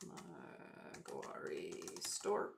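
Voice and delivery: breathy laugh through the words, slow; laughing whisper. Real, not acted. Streamer laughing at own bird-name flub, whispered. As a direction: giggle it out under your breath.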